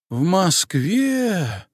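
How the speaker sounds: high, slow, astonished gasp; stunned old woman's gasp; gasping in surprise. A narrator's reading. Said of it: "охнула Варвара".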